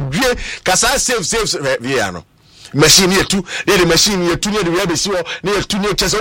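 normal talking voice

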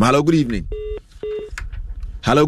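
normal talking voice

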